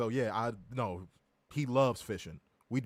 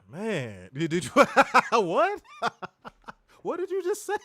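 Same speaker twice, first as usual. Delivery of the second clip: laughing disbelief; 7 semitones higher, 6 dB louder than usual. Real, not acted.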